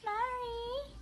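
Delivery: high call of the name. Real, not acted. Owner calling the pet Larry by name.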